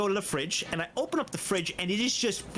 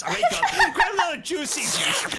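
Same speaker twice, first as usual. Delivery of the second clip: high, loud, through laughter; laughing while talking; 8 semitones higher, 5 dB louder than usual. Real, not acted. Telling a friend about the juice, laughing. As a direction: tell it while cracking up. Cracking up at own story.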